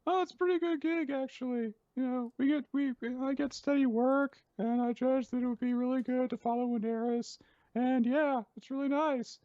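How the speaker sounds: very high, loud cartoon voice; Ninitsi the Astral. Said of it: "and Magu's like".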